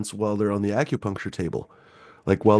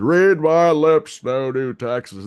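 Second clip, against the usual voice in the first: slow, drawn-out, higher and louder presidential promise; Bush Senior impression; 6 semitones higher, 5 dB louder, much slower than usual. Mocking a president's famous campaign promise, slow and grand. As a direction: stretch each word out like a campaign pledge. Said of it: "he said".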